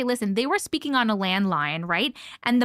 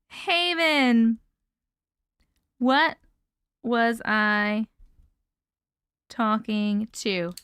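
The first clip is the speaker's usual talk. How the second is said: slow, broken word by word with long pauses; writing it down; much slower than usual. Real, not acted. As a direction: spell it out slowly as you write. Repeats it while writing it down.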